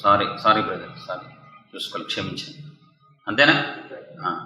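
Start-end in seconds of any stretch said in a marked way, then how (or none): 0.0-2.6 low, soft, passing apology; casual apology. Acted. Passenger to another after a bump, casual quiet apology. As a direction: murmur a small polite sorry, trailing to near whisper.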